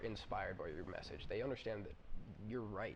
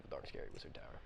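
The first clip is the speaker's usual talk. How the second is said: low breathy spooky narration; ominous hushed tone; 8 semitones lower, 5 dB quieter, faster than usual. A narrator's reading. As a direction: say it dark and secretive. Game master naming the dark tower.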